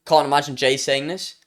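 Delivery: usual speaking voice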